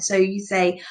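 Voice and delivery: ordinary delivery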